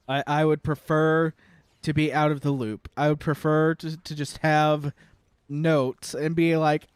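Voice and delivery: ordinary talk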